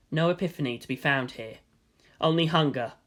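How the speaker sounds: usual speaking voice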